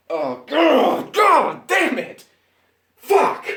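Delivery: anguished outburst. Real, not acted. Fan exploding at a flashback scene, anguished shouting. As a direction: scream out the frustration.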